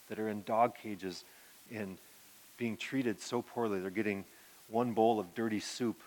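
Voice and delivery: quiet, earnest recollection of the wife; tender retelling. Retold or imitated. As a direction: speak them gently, as if moved. Her heart was so sweet.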